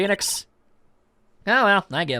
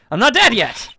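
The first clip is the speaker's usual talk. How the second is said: loud protest; 4 semitones higher, 5 dB louder than usual. Acted. Protests the mourning.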